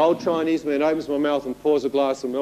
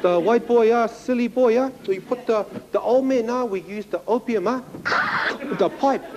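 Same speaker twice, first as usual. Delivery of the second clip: put-on Chinese-accented scolding; 7 semitones higher than usual. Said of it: "the young Chinese boy stood up, he said".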